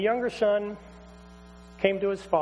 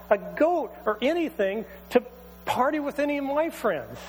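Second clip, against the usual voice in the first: indignant protest; 8 semitones higher than usual. Acted. Older son protesting to his father, angry and indignant. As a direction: hurl the grievance at the father.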